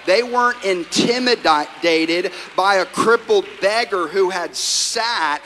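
rousing sermon climb, loud in the middle; preaching at full force. Acted. Pastor to his congregation, preaching at full force. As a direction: swell up loud on the crippled beggar line, then ease into a slow quote.

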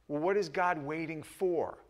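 ordinary talk

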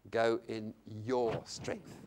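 slow, low line leaning on "your"; stress on YOUR. Retold or imitated. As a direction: draw out "your" as the key word. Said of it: "the emphasis on the word your".

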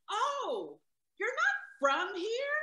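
high, drawn-out questioning; imitating surprised woman. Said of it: "And she said".

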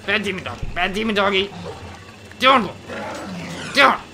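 loud, high dog scolding; frantic high-pitched telling-off; scolding shout. Real, not acted. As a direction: yell at a misbehaving dog. Scolding attacking demon dogs.

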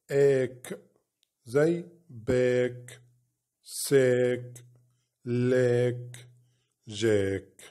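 each syllable then word, like فا then فات; phonics drill. Shown as a demonstration. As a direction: sound out "آت" slowly, part then whole. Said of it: "تبقى". (تهجئة صوتية مقطع مقطع)

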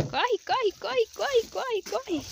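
high, quick urging run run; urgent calling. Real, not acted. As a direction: hurry them out of the rain.

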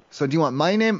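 ordinary talk, nothing marked

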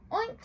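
a high squealing oink; pig oink. Acted. Pig asks for room, high oink. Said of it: "said Pig".

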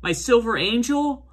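retold alarm, rising high at the end; lightly acted, high-pitched disbelief; mock excited protest. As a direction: imitate a worked-up owner fearing for a ship. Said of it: "this gets Trace all excited and saying".

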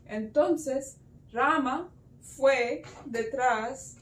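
usual speaking voice

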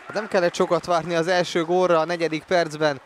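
excited commentary. Real, not acted. Still thrilled after the goal.

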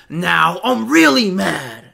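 loud, slow mock anger; hammed-up catchphrase, drawn out; mock mad catchphrase. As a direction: play mad, stretching every word. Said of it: "Chad, who says this?".

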